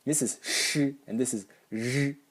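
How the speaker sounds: sh as a hiss, then the same sound voiced as zh. Shown as a demonstration. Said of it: "You basically voice the noise".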